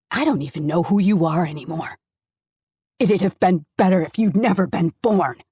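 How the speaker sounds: loud, bitter scolding; angry tirade. Acted. Father berating daughter in car, loud and angry. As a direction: pound every accusation at her.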